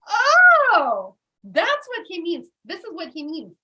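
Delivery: sudden high, loud gasp of understanding; aha realization. Retold or imitated. Finally getting Tony Robbins' words, loud sudden aha. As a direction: burst out as it finally clicks.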